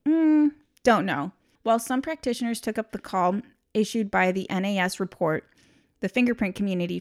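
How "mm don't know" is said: high, slow dismissive mumble; mock dismissive. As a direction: shrug it off like a know-it-all. Mocking dismissive practitioners.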